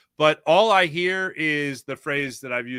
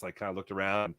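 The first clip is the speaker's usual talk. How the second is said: ordinary talk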